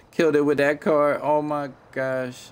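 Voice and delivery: loud, high hype; excited. Real, not acted. Gamer hyping the new car to viewers, loud and excited. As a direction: shout the praise, then stretch out oh my gosh.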